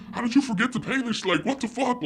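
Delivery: high-pitched retold disbelief; incredulous outburst. Child confronting dad over unpaid house, incredulous. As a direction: demand it high, in mock outrage.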